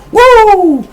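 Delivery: a high, loud Ric Flair woo; high woo yell. Retold or imitated. Imitating Charlotte's woo cry to the crowd, loud and high. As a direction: belt out a shrill woo. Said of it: "the person's like".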